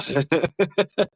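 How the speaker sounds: short run of amused chuckles. Real, not acted. Host amused at getting ahead of things, chuckling. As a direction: chuckle briefly between thoughts.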